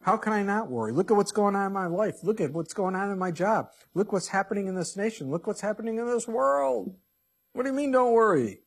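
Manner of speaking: a worried churchgoer pushing back, climbing to an exasperated peak; worried objection. Pretend. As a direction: voice the listener's rising exasperation. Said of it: "you may be sitting there going".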